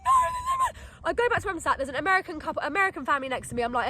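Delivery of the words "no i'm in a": very high, drawn-out mock wail; slow squealing sob, acted; hammed-up crying. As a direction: bawl it in comic hysteria. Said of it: "I'm then profusely crying".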